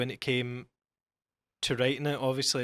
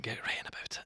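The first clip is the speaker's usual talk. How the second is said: whispering; 3 semitones lower, 12 dB quieter, faster than usual. Shown as a demonstration. Urging co-host to whisper closer.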